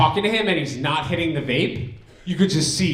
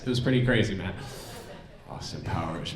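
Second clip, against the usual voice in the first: ordinary delivery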